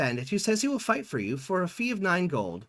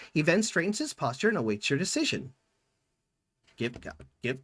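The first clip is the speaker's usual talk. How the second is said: ordinary talk, nothing marked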